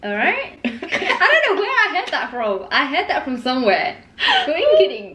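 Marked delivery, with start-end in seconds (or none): 4.4-5.1 put-on laughing high American accent. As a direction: say Coinkydink in a goofy American accent. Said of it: "what is that?".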